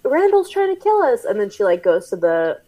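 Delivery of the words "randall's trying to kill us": high, hurried mock panic; a lightly voiced frantic Mike; panicky Mike voice. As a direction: squeak it out in mock alarm.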